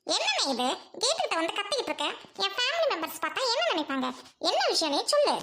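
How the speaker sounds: haughty sister scolding at her gate; snobbish rich sister. Acted. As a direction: talk down to the noisy crowd at the gate. Sister snubs poor brother.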